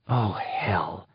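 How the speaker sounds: whispered, under her breath; murmured curse. A narrator's reading. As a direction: mutter it to herself in dismay. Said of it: "she murmured".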